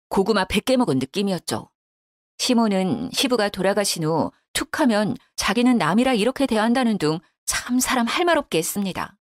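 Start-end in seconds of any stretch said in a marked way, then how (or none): none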